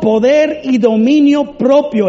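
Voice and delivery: loud, high, forceful preaching; fervent deliverance prayer. Acted. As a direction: cast out fear with full authority. Oración de liberación ferviente. (oración ferviente, fuerte y aguda)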